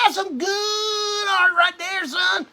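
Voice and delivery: high, slow and wailing, a hammed-up cry; high drawn-out mock sob of praise; overdone mock praise. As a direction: stretch it out like a fake emotional cheer. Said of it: "I'm telling him".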